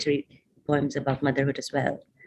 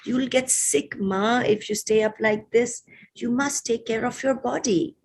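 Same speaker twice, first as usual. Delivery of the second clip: higher-pitched, gentle coaxing; wheedling; 8 semitones higher than usual. A narrator's reading. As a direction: coax your mother to look after herself. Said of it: "I keep on wheedling".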